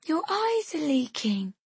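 small son's voice, pitched high; small child. A narrator's reading. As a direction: ask like a puzzled little child. Small son talks to mother.

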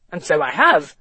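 testy commissioner's character voice; put-on voice, mildly indignant; annoyed retort. A narrator's reading. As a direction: retort as if affronted. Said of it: "Felbrigg retorted, visibly annoyed".

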